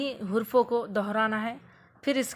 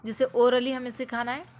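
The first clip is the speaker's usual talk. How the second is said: ordinary talk, nothing marked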